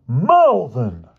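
high, loud outcry; exclamation. A narrator's reading. Boy naming the culprit to the headmaster, loud exclamation. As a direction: cry out the culprit's name. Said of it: "George exclaimed".

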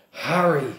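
plain reading voice

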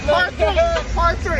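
excited. Real, not acted. Cheering at party.

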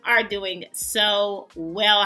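low, slow heartfelt wish to the family; tender wish. Real, not acted. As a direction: ease into it gently, like family. Slow soft well-wishing to viewers.

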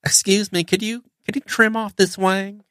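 high, sugary Southern-accented mimicry. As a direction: play the polite Southern inspector in a high sweet voice. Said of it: "sweet Southern accent".

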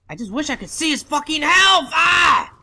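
high, loud burst of exasperation; frustrated shout. Real, not acted. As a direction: yell it in real frustration, ending on a cry. Frustrated at game, health not visible.

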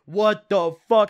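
loud, slow, furious yo's; angry yelling. Retold or imitated. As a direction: bark it out, outraged. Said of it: "mad as hell".

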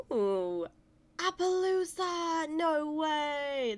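high, loud, slow drawn-out squeal of joy; thrilled. Real, not acted. Gamer squealing on finding an Appaloosa. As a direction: cry out the name in delight. Finds wanted horse breed.

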